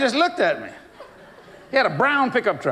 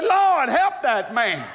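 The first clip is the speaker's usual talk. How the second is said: shouted prayer; 8 semitones higher than usual. Retold or imitated. Preacher praying for the cussing man, high laughing shout. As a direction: cry out to God, half laughing.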